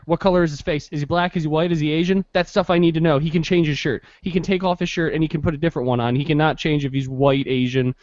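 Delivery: ordinary delivery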